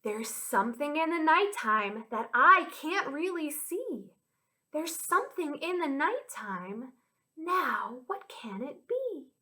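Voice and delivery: put-on lilting children's rhyme with swooping pitch; rhyme recital. Teacher sharing a nighttime rhyme with children, lilting. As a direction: chant it with playful mystery. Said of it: "this rhyme goes like this".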